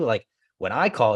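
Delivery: usual speaking voice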